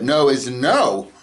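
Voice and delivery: ordinary delivery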